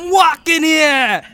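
loud, drawn-out Italian-American caricature; Italian-American accent. Acted. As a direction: holler it like a New Jersey Italian. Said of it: "That's Italians".

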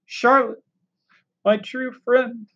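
moaning. A narrator's reading. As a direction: draw the words out in sorrow. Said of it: "he moaned".

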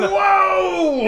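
startled listener crying out; surprised shout. Real, not acted. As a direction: yelp in surprise. Reacting to keg threat.

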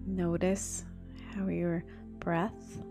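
hushed, slow meditation voice; soft spa whisper. Acted. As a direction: breathe the words softly, spa calm. Continues guided breathing.

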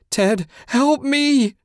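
very high, loud, drawn-out wail; desperate moan. A narrator's reading. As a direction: wail slowly for help in terror. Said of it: "she moaned".

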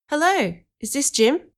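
put-on high-pitched boy's greeting; boy on phone. A narrator's reading. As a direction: pitch it up as a kid making a call. Boy starts nervous phone call.

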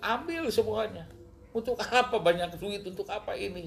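high, insistent appeal; retold exasperated prayer; re-enacted desperate plea. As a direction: throw it all away in a lifted, pleading voice. Acting his desperate answer to God.

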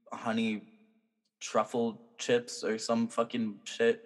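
ordinary delivery

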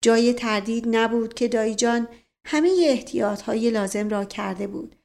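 plain reading voice

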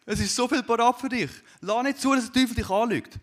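high, loud, impassioned preaching; preaching at full force. Acted. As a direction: call out to the congregation with all you have. Preacher's climactic appeal. (leidenschaftliche, laute, hohe Predigt)

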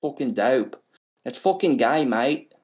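hammed-up Aussie bloke; broad mock Aussie; mock Australian accent. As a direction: play a cartoon Australian. Mock Aussie banter, named later.

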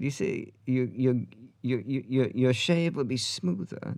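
halting bad Australian accent; mock Australian, groping slowly for the accent; Sam Neill. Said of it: "This is a horrible Australian accent".

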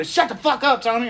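high, dismissive mock annoyance; mock shut-down. Friends silencing the Tombstone fan, mock annoyance. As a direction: cut him off in a high voice. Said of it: "everybody else is being like".

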